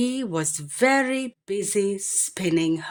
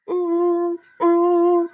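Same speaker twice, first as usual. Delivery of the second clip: very high, louder hooting; owl hoot; 11 semitones higher, 6 dB louder than usual. Acted. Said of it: "asked the owl".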